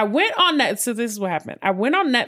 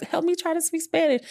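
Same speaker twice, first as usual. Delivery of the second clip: higher, pleading request to a friend; high pleading quote; 9 semitones higher than usual. Retold or imitated. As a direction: beg for help in a high voice. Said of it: "I was like".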